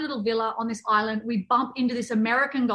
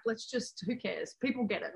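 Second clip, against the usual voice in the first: soft, easygoing don't-care voice; relaxed shrug; 11 dB quieter than usual. Retold or imitated. Parent reassuring herself about screaming kids, softly unbothered. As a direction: let it go, quietly unbothered. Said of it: "Now we're like".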